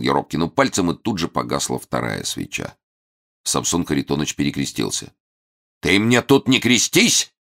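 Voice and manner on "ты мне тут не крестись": high, loud terrifying roar; terrible bark. Acted. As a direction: bark it out to scare him. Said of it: "страшным голосом гаркнул".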